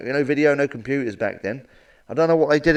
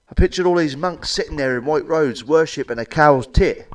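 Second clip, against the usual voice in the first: ordinary delivery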